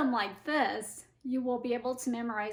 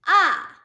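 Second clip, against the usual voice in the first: "ah" falling sharply from high; falling fourth tone; 6 semitones higher, 14 dB louder than usual. Shown as a demonstration. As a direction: drop a loud "ah" top to bottom. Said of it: "the tones again".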